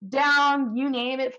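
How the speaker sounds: ordinary delivery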